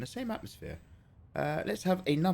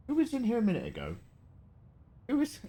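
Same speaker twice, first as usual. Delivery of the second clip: surprised; 12 semitones higher, faster than usual. Real, not acted. Mock call to empty room.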